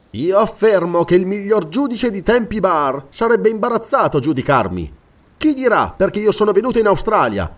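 animated defiance. Acted. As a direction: challenge the accuser with mounting energy. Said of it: "il deportato si animava". (difesa animata e sfrontata)

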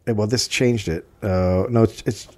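normal talking voice

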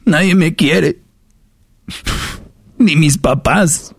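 mock whiny woman, drawn out; whiny self-pity. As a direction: imitate a self-pitying complaint. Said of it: "que diga".

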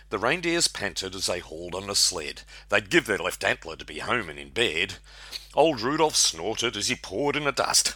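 lively rhyming read-aloud; poem recitation. Acted. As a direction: recite it like a Christmas ballad.

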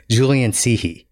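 normal talking voice

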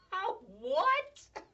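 high squeaky incredulous outburst; mock disbelief. Mock reaction to the crude idea.

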